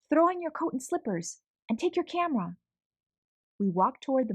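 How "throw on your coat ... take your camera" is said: hurried instructions. Acted. Aunt rushes child at dawn.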